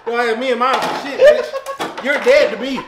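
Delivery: loud joking rant; shouting fake betrayal; mock angry.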